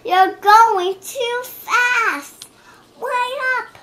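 high, slow, stretched cry. A narrator's reading. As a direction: call after the others to wait up. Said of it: "she cried".

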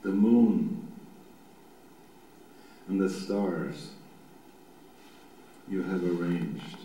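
low, meditative reading with long pauses; slow psalm reading. Shown as a demonstration. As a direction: read it slowly so each image can form. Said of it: "I'm gonna do it nice and slow".

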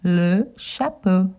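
le chapeau as luh sha-po; French pronunciation. Shown as a demonstration. Teaching children le chapeau in French. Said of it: "Hat in French is".

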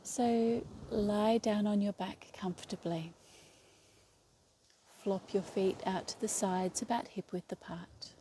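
calm yoga nidra guide with long, restful pauses; guided meditation. Real, not acted. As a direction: lead them into stillness, one unhurried phrase at a time. Leads a yoga nidra relaxation.

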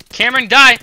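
loud yell at an opponent; forceful, loud taunt; battle shout. Real, not acted. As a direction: yell it while firing at a rival. Shouting at opponent while shooting.

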